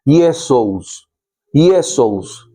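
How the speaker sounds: Iesous said as ee-eh-SOOS, four sounds; Greek pronunciation. Shown as a demonstration. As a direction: pronounce Iesous the Greek way, careful and clear. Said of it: "o se pronuncia Iesous".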